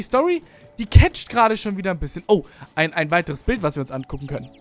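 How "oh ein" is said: ordinary delivery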